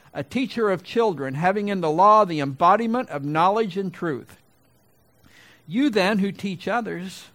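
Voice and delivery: high, forceful declamation with pauses between clauses; scripture reading. Real, not acted. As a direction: read it out as a preacher confronting the congregation. Reads scripture aloud from pulpit.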